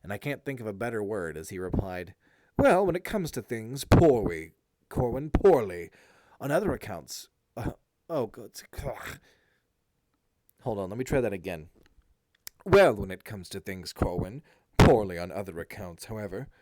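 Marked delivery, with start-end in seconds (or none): 2.6-9.2 drip sarcasm on every word; heavy sarcasm. A narrator's reading. Eric sneering sarcastically at his brother Corwin, heavy sarcasm. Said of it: "his voice heavy with that which men call sarcasm". 12.6-16.5 louder, lower sarcastic Eric; heavy sarcasm. A narrator's reading. Eric answering his brother Corwin with heavy sarcasm. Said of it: "his voice heavy with that which men call sarcasm".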